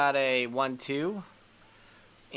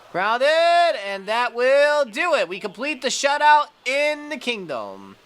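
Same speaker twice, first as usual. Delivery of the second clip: higher and louder, celebrating; triumphant call; 8 semitones higher, 6 dB louder than usual. Real, not acted. Gamer calls the final out of the shutout, triumphant.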